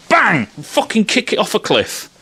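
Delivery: ordinary talk, nothing marked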